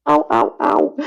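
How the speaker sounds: realising the mother knew, high pained cries; mock pain. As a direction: yelp as if stung by the truth. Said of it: "And I was like".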